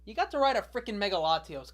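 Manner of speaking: ordinary talk, nothing marked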